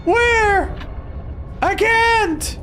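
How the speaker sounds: shrill panicked squeak way up high; high frantic squeak. Real, not acted. Streamer losing the survivor, high panicked squeak. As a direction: squeak out where he went.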